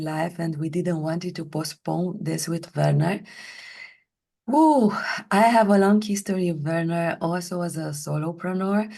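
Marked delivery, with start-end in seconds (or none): 4.5-4.9 high, loud "woo"; loud whoop. Real, not acted. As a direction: let out a quick, high whoop. Exclaims before introducing her history.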